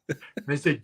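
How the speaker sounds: usual speaking voice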